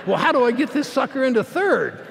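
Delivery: cheeky racer laughing at himself; laughing shout. Retold or imitated.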